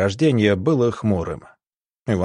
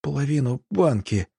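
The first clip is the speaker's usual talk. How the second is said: moaned reply; 5 semitones higher than usual. A narrator's reading. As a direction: moan it weakly through the pain. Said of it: "промычал Сверчок". (слабо промычать от боли)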